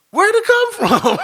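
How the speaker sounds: high, loud, laughing disbelief; baffled question. Retold or imitated. Owner to God about the new foal, stunned high-pitched question. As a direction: blurt it out, totally puzzled. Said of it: "something really stupid".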